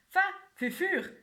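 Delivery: high, loud words through a mouthful; mouth full. Acted. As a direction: talk round a bite of food. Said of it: "la bouche pleine".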